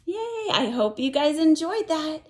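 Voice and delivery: ordinary delivery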